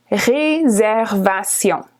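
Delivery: "Réservation" said the French way, slowly, with a uvular r; French pronunciation. Shown as a demonstration. Teacher showing the French word to English speakers, slow model. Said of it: "just pronounced a little bit differently".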